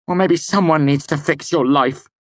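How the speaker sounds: high, loud snap; snapped. A narrator's reading. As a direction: fire it back. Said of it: "I snapped".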